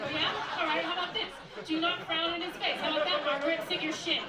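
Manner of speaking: loud, high rant at his wife; angry husband. Acted. Vow writer fresh from fighting his wife, angry shout. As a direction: hurl it like a furious comeback mid-fight. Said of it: "just got into a fight with his wife".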